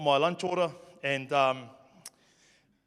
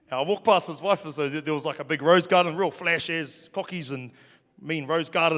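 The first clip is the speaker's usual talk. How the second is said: ordinary talk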